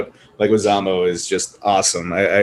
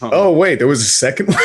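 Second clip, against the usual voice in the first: rising, louder sudden surprise; surprised; 6 semitones higher, 4 dB louder than usual. Real, not acted. Learning there was a sequel, surprised and louder. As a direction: catch it mid-sentence, amazed.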